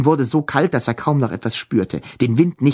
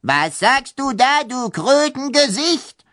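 angry ghost baroness; 12 semitones higher than usual. Acted. Ghost baroness insults Tom.

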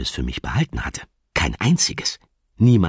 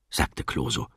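ordinary delivery

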